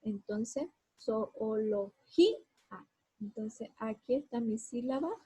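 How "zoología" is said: slow zoología, each syllable apart, "gí" lifted. Shown as a demonstration. Teacher dividing the word for pupils, slow zoología with a stressed "gí". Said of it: "sí o sí tengo que separarla".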